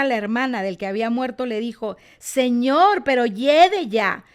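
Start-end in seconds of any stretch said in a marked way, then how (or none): 2.2-4.2 louder, slowed, protesting; quick to react. Retold or imitated. Martha objecting to Jesus at the tomb, alarmed protest. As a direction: blurt out the objection at once. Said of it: "Ella siempre, luego, luego, a reaccionar".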